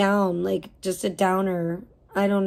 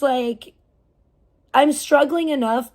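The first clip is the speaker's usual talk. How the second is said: ordinary talk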